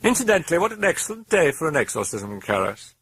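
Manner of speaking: gleeful demon sneer; mocking demon. A narrator's reading. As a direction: taunt the priest from inside the girl. Demon mocks the priest.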